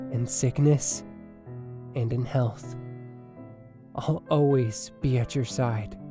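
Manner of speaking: low, measured, loving voice; tender wedding vows. Acted. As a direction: pledge yourself to your bride, pausing between phrases. Groom speaks vows in dream.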